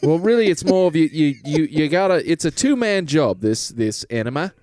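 hammed-up British pitch to the lads; British salesman. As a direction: sell it like a cheeky British huckster. Plays a British Goop salesman.

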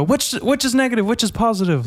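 high-pitched questioning little voice; high comic brain voice; confused brain. Acted. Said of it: "does your brain just go".

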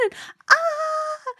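very high breathless squeak of joy; high gleeful squeak; excited squeal. Retold or imitated. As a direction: squeal with glee.

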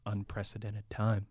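slow, soft, mock-grave delivery; mock solemn. Real, not acted. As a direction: say it like a tired cliché, hushed. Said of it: "Oh, man, that word again".

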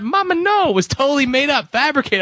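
high squeaky mock protest; imitating a doubting young woman, high and shrill. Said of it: "She thought".

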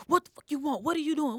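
put-on a boyfriend caught out, barking back; imitating angry boyfriend. Boyfriend opening door angrily.

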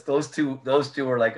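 normal talking voice

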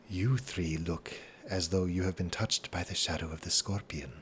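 hushed, ominous stranger's voice; very low voice. A narrator's reading. Mysterious stranger warns three travellers nearby, very low voice. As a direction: speak low and soft, an overheard warning. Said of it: "say in a very low voice".

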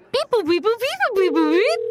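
sung beep boop tones; high electronic beeping; phone beeps. Pretend. Imitating phone dial tones.

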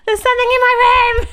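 panicked call to her partner, replayed high; mock panicked plea. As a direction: beg for help as if terrified. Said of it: "And then he comes through and I said".

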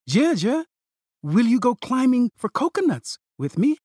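put-on high, eager child voice; hopeful little girl asking; little sister voice. A narrator's reading. Little sister pleading again.

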